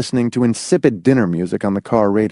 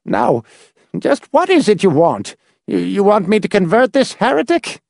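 name the price, miserly; grasping old man; 10 semitones higher than usual. A narrator's reading. The little man charges the visitors, high and miserly.

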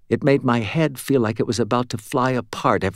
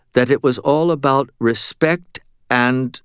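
ordinary delivery

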